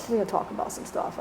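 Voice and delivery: whispered insistence; whispered repeat. Retold or imitated. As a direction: drop to a hushed whisper. Father's demand repeated, whispered.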